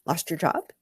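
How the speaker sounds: plain reading voice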